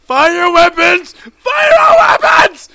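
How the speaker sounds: huge high screaming yell; battle cry. Real, not acted. Yelling at enemies during the firefight. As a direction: scream it like a charge.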